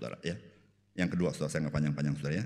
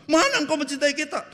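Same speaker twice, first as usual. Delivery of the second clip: high, loud whiny protest; complaining Israelites; 11 dB louder than usual. Acted. Preacher voices angry Israel complaining to God, high and whiny. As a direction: whine like a sulking crowd. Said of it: "Israel marah-marah".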